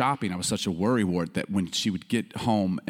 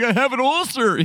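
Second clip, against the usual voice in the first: high, louder hammed-up dismay; mock panic; 13 semitones higher, 4 dB louder than usual. Boy sure he is sick, high mock panic. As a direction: wail it like a worried kid, joking. Worried child imagines having ulcer.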